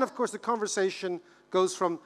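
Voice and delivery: ordinary talk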